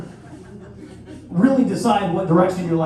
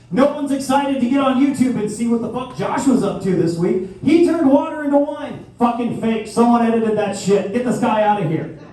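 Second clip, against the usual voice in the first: higher, louder incredulous rant; mock outrage; 5 semitones higher, 4 dB louder than usual. Comic to audience, loud mocking rant about Josh. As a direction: scoff loudly at a fake miracle.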